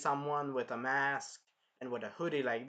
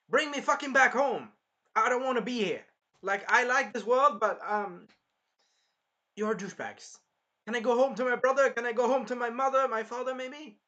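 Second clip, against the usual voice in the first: high, loud frantic plea; imitating a fed-up hero begging loudly; desperate pleading; 8 semitones higher, 6 dB louder than usual. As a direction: beg to be sent home.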